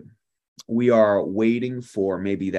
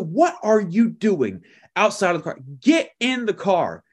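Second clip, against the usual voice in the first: higher mock scolding of a kid; scolding mom; 9 semitones higher than usual. Mom scolds her son hanging out the window, higher mock yell. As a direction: imitate a fed-up mom barking orders. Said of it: "she's yelling at him".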